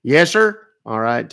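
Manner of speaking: normal talking voice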